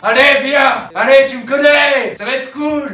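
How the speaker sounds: high, pleading call to come; insistent coaxing. Pretend. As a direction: coax them with exaggerated pleading.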